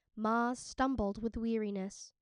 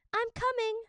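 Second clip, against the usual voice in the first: high, loud call across water; 12 semitones higher, 5 dB louder than usual. Acted. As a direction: shout to reassure the stranger. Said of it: "Minli called".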